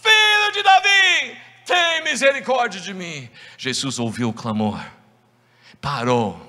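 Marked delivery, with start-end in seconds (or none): 0.0-3.3 very high, loud, slow cry; shouting even louder. Acted. Bartimeu shouting to Jesus even louder, desperate. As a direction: throw everything into the cry. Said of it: "começou a gritar ainda mais".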